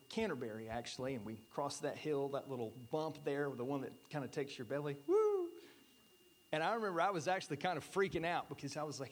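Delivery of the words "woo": whoop, much higher and louder; excited whoop. Retold or imitated. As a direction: let out a rollercoaster woo. Retelling the belly-dropping hill bump.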